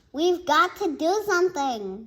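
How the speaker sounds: yelled. Acted. Emery yelling while running out, loud and urgent. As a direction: yell it in a panic. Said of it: "yelled Emery".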